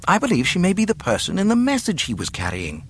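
high, quick droid voice; explaining droid. A narrator's reading. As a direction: explain rapidly, pitched well up. Droid explains who she is.

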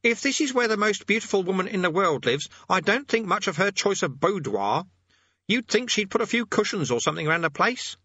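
put-on high, whiny boy's voice; Eric complaining. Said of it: "complained Eric".

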